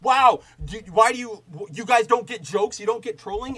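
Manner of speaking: high whiny mock voice; mocking defensive fan. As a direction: whine it like an indignant fanboy. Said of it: "dudes over at Fandom Pulse going like".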